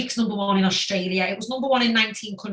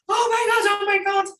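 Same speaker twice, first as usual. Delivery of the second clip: much higher, breathless oh my God; overwhelmed disbelief; 9 semitones higher, 3 dB louder than usual. Retold or imitated. Singer reliving hearing of their first number one, overwhelmed. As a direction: gasp it out, stunned and overjoyed. Crying after the chart news.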